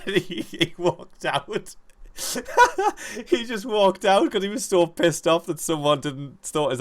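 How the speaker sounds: high, squeaky laughing speech; helpless laughter. Real, not acted. Friend cracking up at Crowe's walkout, laughing through words. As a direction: crack up while telling it.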